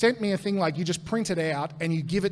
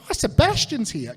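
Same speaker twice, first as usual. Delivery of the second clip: excited discovery; 6 semitones higher, 4 dB louder than usual. Retold or imitated. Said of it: "We're like".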